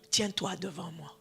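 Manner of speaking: ordinary talk, nothing marked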